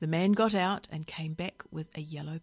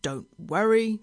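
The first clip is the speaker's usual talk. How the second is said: raised, reassuring call; 4 semitones higher, 6 dB louder than usual. A narrator's reading. Driver calling back to worried passengers, calling out. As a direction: call back over the noise. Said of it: "called Billy Castor".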